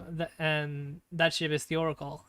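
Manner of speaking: normal talking voice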